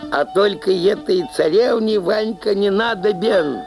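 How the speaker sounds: ordinary talk, nothing marked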